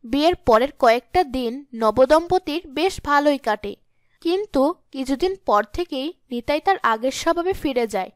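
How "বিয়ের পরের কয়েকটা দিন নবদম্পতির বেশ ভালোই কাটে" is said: usual speaking voice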